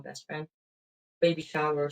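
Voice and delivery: ordinary delivery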